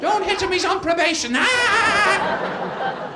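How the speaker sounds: a woman in the middle of the brawl screaming; shrieking woman. Acted. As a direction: squeal it like a panicked wife. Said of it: "women always stand in the middle ... and go".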